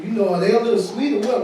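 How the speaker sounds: normal talking voice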